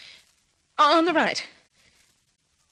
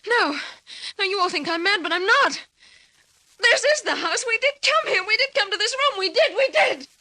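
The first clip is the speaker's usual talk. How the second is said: desperate insistence; 7 semitones higher than usual. Acted. Insists she is not mad.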